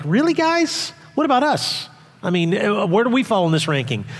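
wyżej, z pretensją, udawane oburzenie; rzuć to jak obrażony kolega, z przekąsem; urażeni uczniowie. Retold or imitated. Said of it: "they're miffed".

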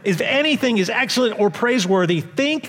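pastor's rising exhortation, full force; building preaching. Acted. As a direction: build the list like a rising litany.

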